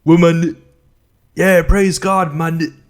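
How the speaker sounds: loud, cut-up rap line with holes where swears were; mock censored verse. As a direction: belt the leftover words like a bleeped track. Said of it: "so that I sound like".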